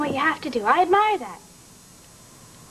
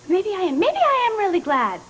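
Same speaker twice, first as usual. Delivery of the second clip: rising higher, building to defiance; defiant outburst; 7 semitones higher, 3 dB louder than usual. Acted. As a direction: throw it back at him. Susie defiant, hurt by Brian.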